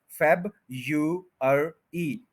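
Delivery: फेब, यू, अर, ई slowly in parts; four separate syllables: फेब, यू, अर, ई; Y variant. Shown as a demonstration. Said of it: "उनमें एक ये भी है". (फेब यू अर ई, वाई के साथ)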